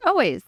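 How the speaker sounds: ALWAYS punched high and loud; emphatic. Real, not acted. Guest answering the host's running joke, emphatic. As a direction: fire back Always with a grin.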